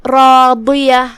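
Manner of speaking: راضیہ slow, with ر and ض full and heavy; heavy ra and dad. Shown as a demonstration. Teacher shows the heavy letters, slow and full. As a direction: stretch راضیہ with a thick ض. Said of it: "ہم اسے بھاری آواز میں ادا کریں گے".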